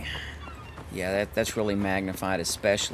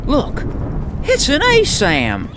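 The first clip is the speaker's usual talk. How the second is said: loud, high, sharp imitation of the voice actor; imitating Jake; 13 semitones higher, 8 dB louder, slower than usual. Streamer imitating the voice actor for Jake, high and loud. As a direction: bark it out high like the character. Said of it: "the voice actor for Jake reminded me".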